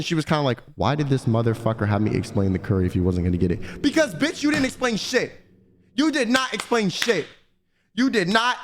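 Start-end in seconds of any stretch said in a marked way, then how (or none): 0.8-3.8 much lower, mumbled inner voice; imagined waitress thought. Retold or imitated. Guessing the waitress's annoyed thoughts, low mock mutter. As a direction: voice what she was thinking, muttered. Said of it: "I could tell by her reaction".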